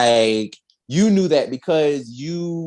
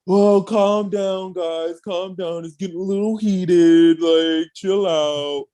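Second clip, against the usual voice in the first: drawn-out, higher mocking voice; mock peacemaker; 4 semitones higher, slower than usual. [name] jumps in to settle the argument, mock soothing. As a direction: imitate a would-be rescuer, dragging out the words. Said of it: "trying to be Captain Save-A-Ho".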